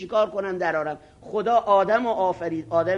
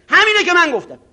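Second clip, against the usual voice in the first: mock shout; 10 semitones higher, 8 dB louder than usual. Arrogant cleric shouting in debate.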